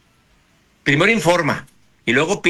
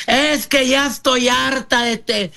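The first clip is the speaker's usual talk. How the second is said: imitating a yeller; 7 semitones higher than usual. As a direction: mimic someone who's had it, pitched way up. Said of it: "y gritando".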